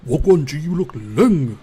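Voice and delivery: loud, exaggerated British accent imitation; mock British slang. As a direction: belt it out in a hammed-up British accent. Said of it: "trying to do this British language challenge".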